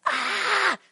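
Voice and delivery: a short high yelp, held back; hint at Mary's scream without hurting ears; mild mock scream. Pastor retelling Mary's fright, a restrained mock scream. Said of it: "I won't do that to you and, and hurt your ears".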